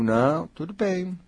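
slow, easy "tudo bem"; calm reassurance. Retold or imitated. A coach quoting her own calm answer, slow and easy. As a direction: settle it calmly, unhurried. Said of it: "Aí eu digo".

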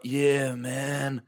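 soft, drawn-out LA stoner-style accent; laid-back Valley accent. Shown as a demonstration. Showing co-host the kind of regional American they can do, Valley accent. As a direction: do a quiet West Coast Valley accent. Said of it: "alley kind of like".

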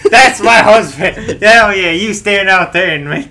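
loud, high, slow proud shout; proud cheer. Pretend. Wife in the car cheering her husband, loud proud shout.

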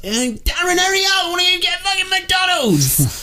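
very high-pitched put-on screech; screeching girlfriend. Girl in the car nagging Darren, high screech. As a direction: screech impatiently like a caricatured girlfriend. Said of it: "He's got this girl in the car".

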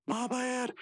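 squeaky high cartoon line. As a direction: pitch way up. Voicing Rigby.

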